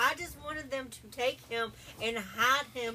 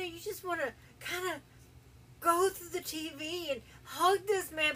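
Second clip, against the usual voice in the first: tearful; 5 semitones higher than usual. Real, not acted. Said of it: "I'm crying now".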